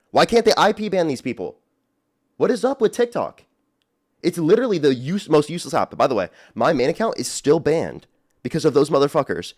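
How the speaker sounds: high-pitched, heated venting; real outrage pouring out; angry rant. Real, not acted.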